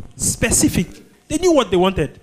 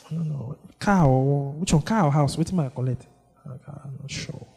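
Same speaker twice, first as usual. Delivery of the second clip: flustered mumbling; 2 semitones lower, 4 dB quieter than usual. Pretend. Flustered person before Jesus.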